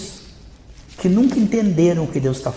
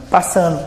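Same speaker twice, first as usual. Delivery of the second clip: "passa ano" dropping back down; falling tune. Shown as a demonstration. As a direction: bring "ano" down to close the list. Lista repetida dos anos passando. ("passa ano" com entonação descendente)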